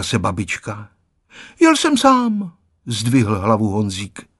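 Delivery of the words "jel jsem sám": put-on proud child. A narrator's reading. Proud boy answers.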